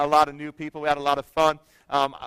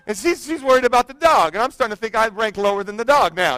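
ordinary delivery

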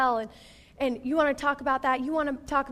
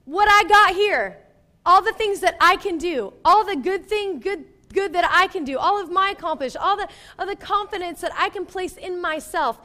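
raised and loud, preaching hard; Paul, passionate; 6 semitones higher, 7 dB louder than usual. Retold or imitated. Paul declaring his accomplishments worthless, loud and high. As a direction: proclaim it with full force. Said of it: "So Paul is saying".

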